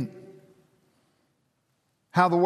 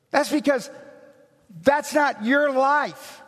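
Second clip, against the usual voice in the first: raised, driving pulpit voice; impassioned preacher, higher and faster; preaching at full force; 10 semitones higher, 3 dB louder than usual. Acted. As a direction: build it up like a sermon climax. Preaching climax.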